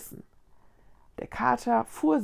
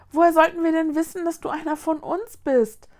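higher, mildly timid question; shy; 7 semitones higher, 6 dB louder, faster than usual. A narrator's reading. Ghost girl excuses herself to the boy, shy. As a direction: offer a timid excuse in a high voice. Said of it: "meinte das Geistermädchen schüchtern".

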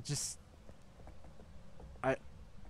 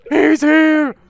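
panicked shouting; 6 semitones higher, 11 dB louder than usual. Real, not acted. Panicked when monster arrives in game.

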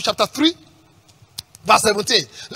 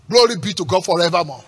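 ordinary talk, nothing marked

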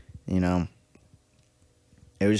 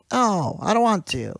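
put-on whiny kid; 10 semitones higher than usual. Kid refusing in retold scene.

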